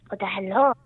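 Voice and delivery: ordinary delivery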